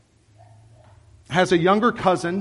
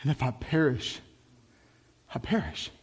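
quiet resolve; 5 semitones lower, 10 dB quieter than usual. Acted. Esther's resolve, quoted.

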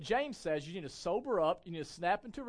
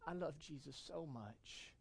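put-on toddler voice; 3 semitones lower, 14 dB quieter, slower than usual. Two-year-old son quoted.

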